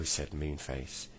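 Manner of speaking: plain reading voice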